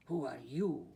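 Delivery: low, growled challenge; growling Chihuahua. A narrator's reading. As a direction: growl the question like a gang-leader dog. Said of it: "growled Don Diego".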